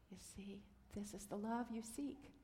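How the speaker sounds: ordinary delivery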